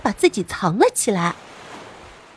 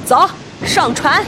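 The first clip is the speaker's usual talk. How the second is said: slow, rough pirate shout; rally the crew to board; pirate war cry; 4 semitones higher than usual. Acted.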